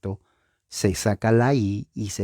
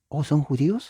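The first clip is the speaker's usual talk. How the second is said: sorpresa fingida; 6 semitones higher, faster than usual. Pretend. Diálogo imaginado, sorprendido.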